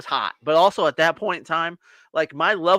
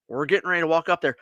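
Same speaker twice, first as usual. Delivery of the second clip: ordinary delivery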